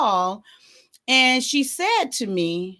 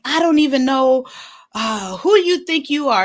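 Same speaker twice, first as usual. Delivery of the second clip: raised, higher retold scolding; mock telling-off, pitched up; fussing; 5 semitones higher, 3 dB louder than usual. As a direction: imitate a mother fussing at her kid. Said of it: "she started fussing at me".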